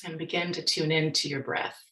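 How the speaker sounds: soft, unhurried meditation voice with long pauses; guided meditation. Real, not acted. Speaker leading workshop audience in mindfulness practice, guided meditation. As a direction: guide listeners gently into their breath, leaving space.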